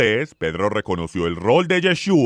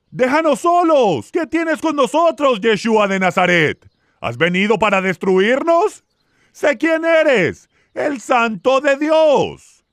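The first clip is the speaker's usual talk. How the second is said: high-pitched cry of a possessed man; acted shout; 9 semitones higher than usual. A narrator's reading. Possessed man confronts Yeshua in synagogue, crying out high. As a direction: narrator acting out a demon's outcry. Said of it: "él gritó".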